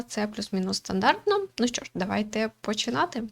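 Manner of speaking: usual speaking voice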